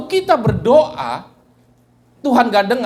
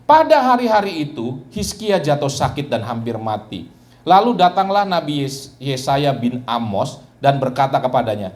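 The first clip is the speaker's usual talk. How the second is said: steady reading of a Bible passage; measured scripture reading voice; 7 semitones lower than usual. Acted. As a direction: read the verses out to the congregation. Reads the Bible passage aloud.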